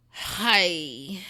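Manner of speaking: ordinary talk, nothing marked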